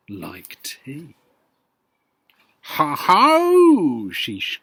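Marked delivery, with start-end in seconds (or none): 2.8-4.1 put-on loud, high crowing old woman; triumphant cry. Grandma catching George with the tea, crowing. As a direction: crow it out in triumph. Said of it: "she cried".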